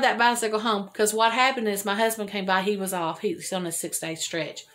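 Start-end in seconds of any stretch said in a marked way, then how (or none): none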